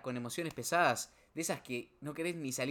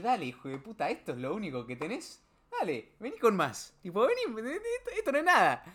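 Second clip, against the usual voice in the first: building from level to much higher and louder; defiant shout at the sky; 9 semitones higher, 6 dB louder than usual. Retold or imitated. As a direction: dare fate to bring more. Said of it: "mirar al cielo y decir". (desafío al cielo, subiendo hasta un grito agudo)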